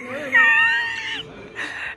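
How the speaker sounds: sudden high-pitched squeal of laughter; a real high laugh bursting out over food; shrieking laugh. Real, not acted. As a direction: let out a shrill, surprised laugh shriek. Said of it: "made that noise so high pitched".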